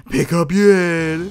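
slow, loud, strained demand; insistent loud demand. Real, not acted. Player urging his target to raise its head, drawn-out loud demand. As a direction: stretch it out loudly. Repeats demand at game enemy.

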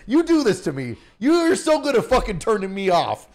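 hammed-up rant. Mock rant roasting his friend.

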